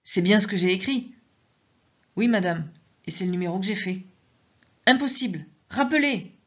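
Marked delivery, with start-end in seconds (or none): 4.9-6.3 sharp, higher command; curt, commanding insistence; authoritative. Acted. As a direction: order him to call back. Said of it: "J'ai été autoritaire".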